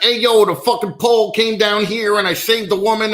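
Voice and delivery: high-pitched, imitated New York accent; New York Con Ed guy. Con Ed guy telling TV news about a rescue, mock New York accent. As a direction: do an excited New York utility worker. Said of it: "some Con Ed guy from New York".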